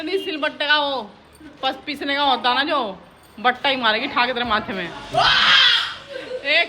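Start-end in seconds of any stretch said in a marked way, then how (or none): 4.8-6.6 sharp high shout of shock; loud yelp. Real, not acted. Uncle yelps when cold water hits him. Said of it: "कितनी तेज चिल्लाया देख".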